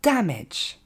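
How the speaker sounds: DAMAGE as "DAM-edge", stressed up front; falling teaching model of DAMAGE; correct English pronunciation. Shown as a demonstration. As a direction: punch DAM, drop to a short "edge" in DAMAGE. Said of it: "mais bien".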